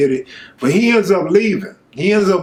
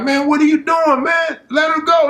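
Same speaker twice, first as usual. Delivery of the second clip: shouting at sheriffs to let mother go; angry shout; 10 semitones higher than usual. Retold or imitated. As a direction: protest hard at the officers dragging mom. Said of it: "I'm like".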